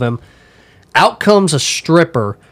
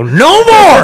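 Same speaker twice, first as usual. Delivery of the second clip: high, loud yelp of protest; panicked shout; 6 dB louder than usual. Retold or imitated. Pummeled at a strip club, he yells stop. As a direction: shout it like you've had enough. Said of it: "I shoot up and I go".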